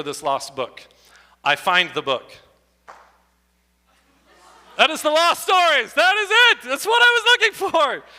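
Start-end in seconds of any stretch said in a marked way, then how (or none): 4.8-8.0 high, loud delighted cry; high loud mock triumph; triumphant discovery. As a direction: proclaim the find like a big reveal. Finding the lost book.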